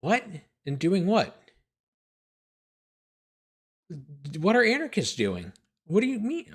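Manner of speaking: baffled disbelief. Real, not acted. Baffled by the essay's sentence.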